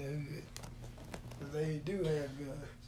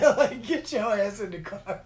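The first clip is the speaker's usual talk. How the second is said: loud, raised mock command; imitating mom's order; 5 semitones higher, 10 dB louder than usual. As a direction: mom ordering the kid along, laughingly retold. Retells mom's exasperated order.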